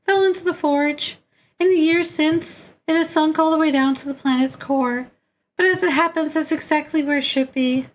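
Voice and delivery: very high put-on voice giving instructions; high-pitched otherworldly character. Character briefing players on the frog's journey, high put-on voice. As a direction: pitch it far up, sustained and steady.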